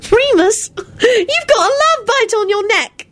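high, slow gleeful teasing. A narrator's reading. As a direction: tease him with delight. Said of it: "she announced gleefully".

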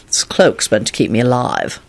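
low, stern explanation. A narrator's reading. Lupin explaining why he keeps his cloak, sternly. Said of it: "Lupin replied sternly".

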